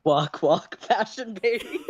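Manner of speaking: a light mock catwalk voice; runway announcer. Reader joking about Dave's catwalk strut, mock runway hype.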